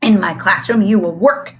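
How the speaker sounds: loud barked order; barking. A narrator's reading. The strict teacher barking at the students, loud. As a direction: bark it at the class. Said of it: "he barked".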